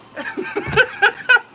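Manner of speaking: sudden high laugh at the cards; high burst of laughter. Real, not acted. Tarot reader laughing at her own reading, real laugh.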